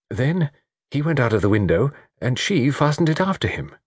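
halting start, growing surer; hesitant. A narrator's reading. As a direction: work out each step as you speak.

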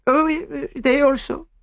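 put-on high, hesitant accented maid; French maid voice. A narrator's reading. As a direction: answer timidly, French accent. Celestine answering Poirot.

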